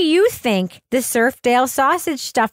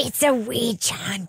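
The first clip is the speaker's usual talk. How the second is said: put-on quiet, slow New Zealand accent; a hammed-up New Zealand accent for the panel; 2 semitones lower, 6 dB quieter, slower than usual. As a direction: repeat it slowly and softly in a New Zealand accent. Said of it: "That's an amazing accent".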